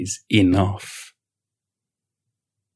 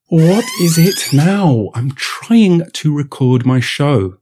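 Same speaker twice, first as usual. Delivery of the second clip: slow, higher, weary whine; exasperated drawl; 4 semitones higher, 3 dB louder, much slower than usual. Acted. Podcaster interrupted again by Vlad, slow exasperated whine. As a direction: drag it out, fed up.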